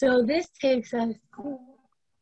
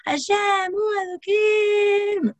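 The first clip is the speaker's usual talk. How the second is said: one big loud proclamation, pitched way up; full-voice shout; 12 semitones higher, 4 dB louder, slower than usual. Shown as a demonstration. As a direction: give it everything you have, all at once. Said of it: "everybody give it everything you have".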